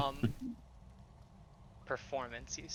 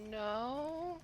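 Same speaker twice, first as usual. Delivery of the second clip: drawn-out, much higher questioning no; hesitant questioning no; 11 semitones higher, 4 dB quieter than usual. Acted. Browbeaten character answering an offer, hesitant question. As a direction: lift it high and unsure, in character. Acted reply in faked mayonnaise conversation.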